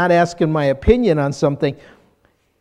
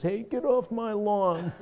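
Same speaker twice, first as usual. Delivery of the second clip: higher, soft mock yell; angry old man; 5 semitones higher, 7 dB quieter than usual. Angry old man at his door to kids on the lawn, mock yell. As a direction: imitate an old man calling at kids, held back. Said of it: "yells at the kids".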